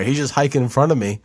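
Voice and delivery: ordinary delivery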